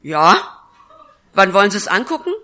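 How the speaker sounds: blunt, loud and fast, no small talk; a no-nonsense woman getting straight to business; brisk and curt. Retold or imitated. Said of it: "ganz klar und ganz straight und ganz kurz".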